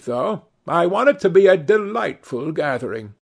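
Lady Narborough. A narrator's reading.

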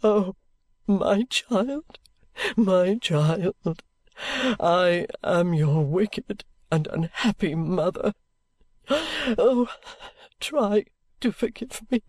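slow, aching plea with a touch of tears; a mother begging her daughter's forgiveness; Lady Dedlock on her knees, pleading with her child. A narrator's reading. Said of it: "cried to me".

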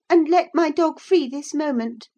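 put-on high, loud, demanding girl; indignant order. Girl orders the woman to free her dog, indignant. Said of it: "tears sprang into her eyes with indignation".

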